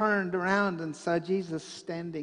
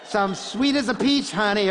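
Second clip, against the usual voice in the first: playful call-out; 4 semitones higher, 8 dB louder than usual. Shown as a demonstration. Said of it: "get some activity".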